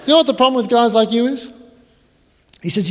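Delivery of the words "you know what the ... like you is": much higher, loud public jab; loud, high drunk guest repeats it; big loud voice. Retold or imitated. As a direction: boom it to the whole table. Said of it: "In a big, loud voice".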